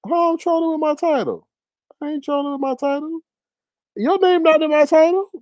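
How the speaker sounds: high, incredulous denial; indignant. Real, not acted. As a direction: act offended at the accusation. Answers chat accusing him of trolling.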